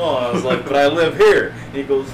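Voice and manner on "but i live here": loud, insistent protest; loud drunk insistence. Retold or imitated.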